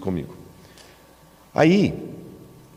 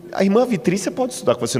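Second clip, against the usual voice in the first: high flustered priest; 11 semitones higher than usual. Retold or imitated. Priest deflecting, voice jumps up.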